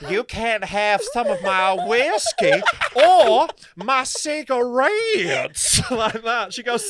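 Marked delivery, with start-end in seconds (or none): 0.1-5.8 slow, loud, mangled Southern drawl; hammed-up fake American South; bad Southern American accent. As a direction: stretch the vowels in a terrible Southern US accent. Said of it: "tries to do a Southern American accent".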